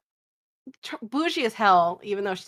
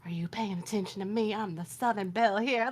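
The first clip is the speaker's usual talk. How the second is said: dramatic Southern belle; 7 dB quieter than usual. Retold or imitated. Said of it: "she has to pause dramatically".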